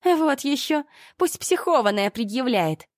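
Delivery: high, scornful brat voice; snippy Olga. A narrator's reading. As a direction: toss it off dismissively. Ольга огрызается на полицейского. (дерзкий высокий голос)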